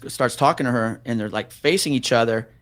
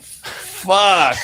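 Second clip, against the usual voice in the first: higher, drawn-out expletive of shock; retold stunned curse; 7 semitones higher, 3 dB louder than usual. Officer seeing the woman breathe strangely, mock stunned. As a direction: act out stunned dread on one word.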